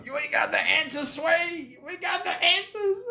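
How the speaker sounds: shrill high yelling imitation of [name]; mock [name] rant. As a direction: yell it up high like a frantic rapper. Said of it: "like him and Sway there".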